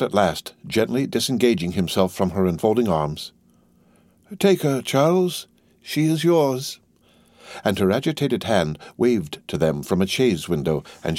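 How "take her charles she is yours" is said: high, slow, drawn-out parting words; lifted, lingering father's farewell; father giving away daughter. A narrator's reading. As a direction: let her go slowly, in a raised voice. Father hands over bride at parting.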